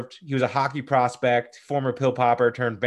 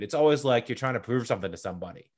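ordinary delivery

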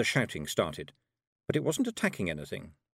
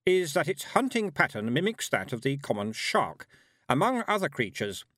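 higher, reedy lecturing voice; 6 semitones higher than usual. A narrator's reading. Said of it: "a reedy voice began".

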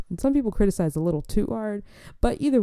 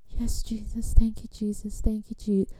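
mock fervent praying; 3 semitones higher, 3 dB quieter than usual. Guest asked to say grace, mock-fervent hushed praying. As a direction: imitate someone lost in private prayer. Mimics person lost in fervent prayer.

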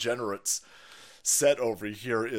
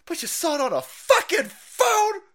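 incredulous yell; 7 dB louder than usual. Real, not acted. Ranting at phone viewers.

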